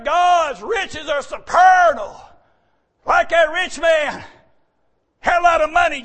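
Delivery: preaching. Acted. Preaching at full force.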